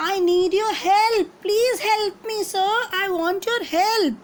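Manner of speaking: a small rabbit pleading at the cave mouth; loud voice. Acted. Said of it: "he said in a loud voice".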